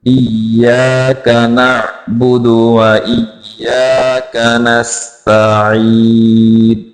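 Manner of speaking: slow, drawn-out tajweed recitation; measured model recital for learners; Quranic recitation. Shown as a demonstration. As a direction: recite the verse slowly, holding the long vowels.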